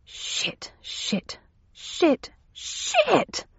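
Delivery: whispered curses rising to a high cry; muttered curses building. Acted. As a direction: start under your breath, then climb sharply.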